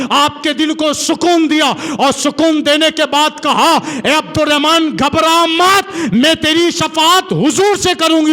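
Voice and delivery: full-voiced preacher's climax; preaching at full force. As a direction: pour emotion into the story like a passionate preacher.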